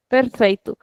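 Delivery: ordinary talk, nothing marked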